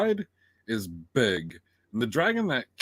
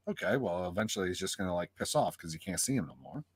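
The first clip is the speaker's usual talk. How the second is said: low, soft musing to oneself; settled relief; 7 semitones lower, 8 dB quieter, faster than usual. Retold or imitated. Viewer retells thinking the chase is over, quietly reassured. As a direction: drop low and soft, reasoning it through. Retold relieved reasoning while watching.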